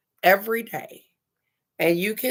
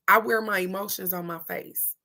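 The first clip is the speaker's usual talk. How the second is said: ordinary delivery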